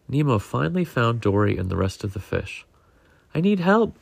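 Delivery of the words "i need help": high, urgent plea, slightly louder. A narrator's reading. Nemo finds the other fish and asks urgently for help. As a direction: plead for help in a raised pitch. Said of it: "Nemo said urgently".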